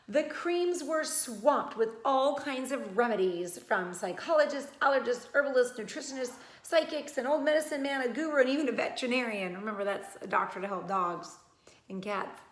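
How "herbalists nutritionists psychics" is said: plain reading voice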